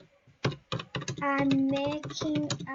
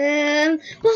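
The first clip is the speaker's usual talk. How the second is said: ordinary talk, nothing marked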